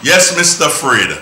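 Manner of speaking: loud and clear for the caller; to someone hard of hearing. Real, not acted. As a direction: speak up so he hears. Caller can't hear well.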